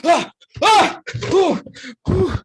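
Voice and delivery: loud, high startled gasps; revival gasps. Acted. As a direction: gasp and whoop like someone jolted back to life. Revived capybara wakes in shock.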